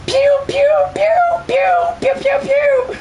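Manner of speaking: high squeaky blaster sounds; playful high laser pew pews; pew pew laser noises. Pretend. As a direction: fire off toy laser noises. Mimicking laser gun fire.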